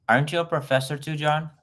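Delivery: ordinary talk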